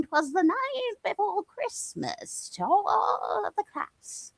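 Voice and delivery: put-on high, slow old granny reading voice; lightly voiced grandma reading a bedtime poem; elderly grandmother voice. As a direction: read it like a sweet old grandmother at storytime. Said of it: "as the elderly grandmother reading".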